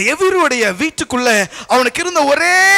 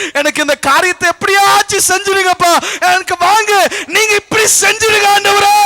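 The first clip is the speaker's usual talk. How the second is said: high, crying-out appeal; loud, pleading prayer; 10 semitones higher, 5 dB louder than usual. Acted. Believers begging the Lord for help, praying loudly. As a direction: plead with God at full voice. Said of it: "நிறைய நேரத்துல வேண்டுறோம்ல".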